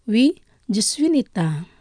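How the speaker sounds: usual speaking voice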